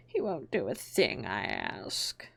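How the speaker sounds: low, slow, whispered lament; mournful. A narrator's reading. Hatter lamenting to Alice, low and mournful. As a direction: sigh it out sadly. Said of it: "in a mournful tone".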